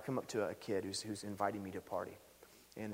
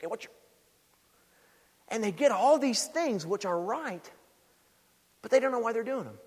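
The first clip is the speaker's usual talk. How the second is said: louder, higher, driving preacher's build; full-force preaching; 10 semitones higher, 8 dB louder than usual. Acted. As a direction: preach it hard to the congregation.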